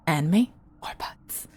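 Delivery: hushed, voiceless question; conspiratorial whisper. Pretend. Asking Emma which she likes better, whispered. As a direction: whisper it like a weighty secret.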